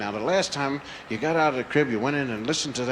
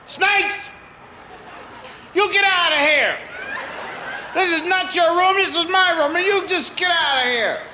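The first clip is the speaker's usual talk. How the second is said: put-on yelling child; 14 semitones higher than usual. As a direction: order the snakes out of the room. Child yells at invisible snakes.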